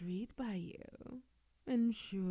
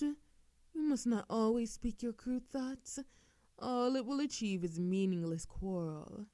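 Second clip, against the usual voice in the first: normal talking voice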